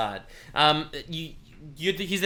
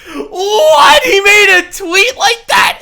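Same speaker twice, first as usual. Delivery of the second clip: very high, loud shout of disbelief; shocked outcry; 15 dB louder, much slower than usual. Real, not acted. Streamer reads the tweet and shouts, shocked. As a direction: cry out in shock.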